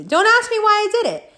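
head off the question in a high voice; high, insistent self-defence; defensive plea. Real, not acted. Defensive repeat to audience.

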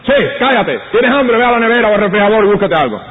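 mock a careless mother snapping at her baby; hammed-up annoyed mother; mock impatient mother. Mother mocked scolding hungry baby.

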